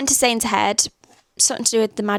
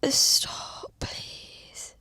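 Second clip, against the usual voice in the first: whispered plea through tears; tearful whisper; 8 dB quieter than usual. Real, not acted. Reader begging the book to stop, whispering. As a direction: beg the author in a whisper. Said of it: "I'm crying".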